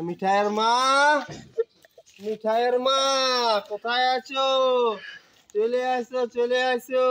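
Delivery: loud, high call across a distance. Pretend. Man calling out loud for the missing mother of his child. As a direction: shout her name as if she's far away.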